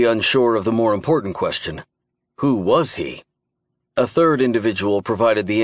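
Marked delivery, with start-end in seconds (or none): none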